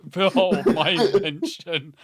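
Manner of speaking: loud, grandly slowed unveiling; hammed-up proud reveal of a supposed creation; mock inventor's boast. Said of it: "pretending it's an invention he made".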